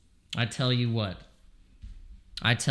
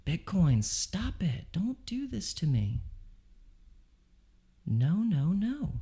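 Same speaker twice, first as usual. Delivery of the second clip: higher and softer, begging the chart; desperate quiet pleading; 5 semitones higher, 7 dB quieter than usual. Real, not acted. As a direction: plead with it under your breath.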